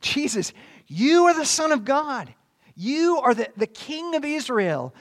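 acted shout of worship; Nathanael. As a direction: cry it out in praise, high and loud. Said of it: "hear Nathanael shouting these words".

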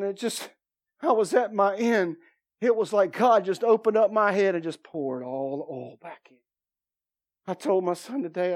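ordinary delivery

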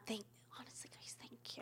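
hushed, rushed thanks; quiet, near-whispered thank-you; barely audible. Acted. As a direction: mumble thanks almost under your breath. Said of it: "I'm sorry, I can't hear you".

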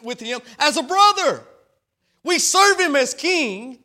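high, loud, driving preacher cadence; full-throated gospel sermon; preaching at full force. Acted. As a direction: preach it out to the whole church.

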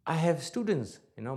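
usual speaking voice

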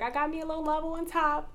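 high, light mock voice of the friend; imitating a friend dodging the word pregnant. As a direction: toss it off like she's hiding the news. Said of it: "She was like".

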